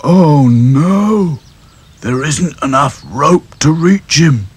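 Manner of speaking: slow, fretful doll voice; worried Sad Sack. Acted. Gloomy doll worrying about rope, fretful. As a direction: fret over the rope. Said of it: "thought Sad Sack".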